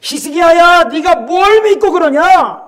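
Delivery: high, drawn-out taunting call; mocking shout. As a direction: shout a jeer up at the city. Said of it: "히스기야를 조롱하면서 외치는 거예요". (조롱하듯 높고 길게 외치는 목소리)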